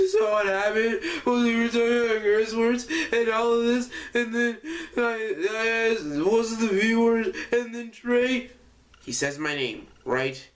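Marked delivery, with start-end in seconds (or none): 0.1-1.0 high-pitched tearful kid imitation; mock crying. Crying boy answers the teacher, high mock-tearful voice. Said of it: "he's in tears, just crying". 1.3-8.5 high, wailing crybaby imitation; mock crying. Crying boy tells on his friends to the teacher, high mock-sobbing voice. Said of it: "just crying".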